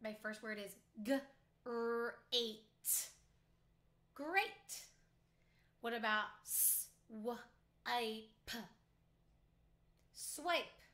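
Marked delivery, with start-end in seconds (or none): 1.0-2.6 g-r-ate said sound by sound, slowly, each sound apart; chopped into sounds. Shown as a demonstration. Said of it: "I'm going to chop the word first". 6.4-8.6 g-r-ate said sound by sound, slowly, each sound apart; chopped into sounds. Shown as a demonstration. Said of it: "I'm going to chop the word first".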